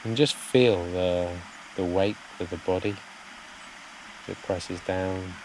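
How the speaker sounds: soft, unhurried meditation voice with long pauses; guided meditation. Real, not acted. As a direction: gently guide listeners to settle, phrase by phrase.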